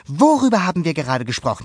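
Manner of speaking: quick, loud scolding; upset scolding. A narrator's reading. Luca scolds his goatfish, quick and upset. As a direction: scold the runaway fish. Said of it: "sagte Luca aufgebracht".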